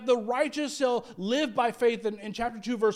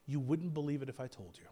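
quiet God reply; 7 semitones lower, 8 dB quieter than usual. Acted. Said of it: "That's what God was saying".

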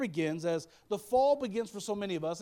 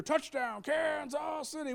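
slow, high shouted touchdown call; booming play-by-play call; announcer call; 7 semitones higher, slower than usual. Retold or imitated. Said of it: "you hear".

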